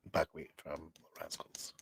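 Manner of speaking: soft breathy whisper, slowed down; whispered aside. Real, not acted. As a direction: drop to a secret whisper. Whispered aside about Buckwheat.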